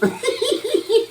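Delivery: very high-pitched giggle; squealing high giggle; giddy cackle. Real, not acted. Giddy cackle over the food.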